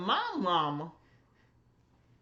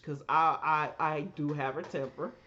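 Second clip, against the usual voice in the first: usual speaking voice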